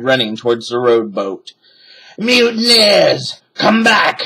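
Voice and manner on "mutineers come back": slow, much higher, loud bellow; shouted. A narrator's reading. Captain yells at fleeing crew, loud bellow. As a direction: bellow after the deserters. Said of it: "shouted Captain Bones".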